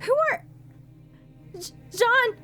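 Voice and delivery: high, panicked calls; rising fear into a cry for Jean; growing alarm. Acted. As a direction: explorer seized from behind, panicking. Frightened at discovery.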